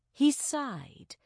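plain reading voice

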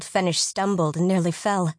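usual speaking voice

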